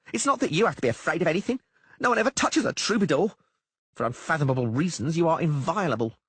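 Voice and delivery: high, brisk halfling voice; halfling Dainty. A narrator's reading. Halfling Dainty brushing off the poet's fears, high and brisk. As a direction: tease the poet in a quick high halfling voice.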